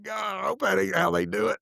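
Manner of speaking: a jokey cry of dread at judgment; mock shout. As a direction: blurt it out half-joking. Mock exclamation, shout heard.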